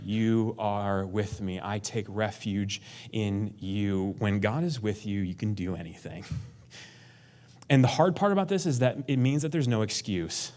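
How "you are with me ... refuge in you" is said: slow, soft, settled; calm trust. Retold or imitated. David resting in God after panic, slow and soft. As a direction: sink into quiet trust.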